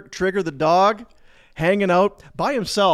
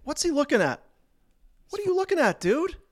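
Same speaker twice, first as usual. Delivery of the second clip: much higher, hammed-up clueless brawler; mock dazed fighter; 11 semitones higher than usual. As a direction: ham up the confused drunk's squeak. Voicing the fighter again, bigger.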